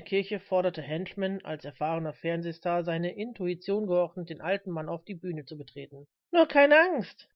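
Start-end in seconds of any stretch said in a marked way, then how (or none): 6.3-7.3 high, loud encouraging shout; friendly call. A narrator's reading. Reverend encouraging a timid old man, friendly loud call. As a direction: call out reassurance across the hall. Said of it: "rief er freundlich".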